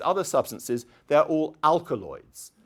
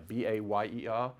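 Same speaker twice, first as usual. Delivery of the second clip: ordinary talk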